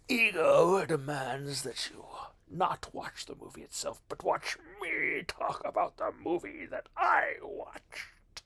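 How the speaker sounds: hammed-up, self-important demand; mock egomaniac. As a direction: play the puffed-up ego for laughs. Mock egotistical demand to viewers.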